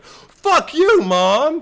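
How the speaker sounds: put-on very high, loud teen yell; mock furious shout. Imagined daughter yelling at her mom, mock furious shout. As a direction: scream it back at mom in outrage. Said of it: "It's like".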